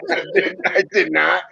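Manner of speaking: high, giggling protest through laughs; real laughing no-I-didn't; laughing denial. Real, not acted. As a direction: deny it while you can't stop laughing. Denying while laughing.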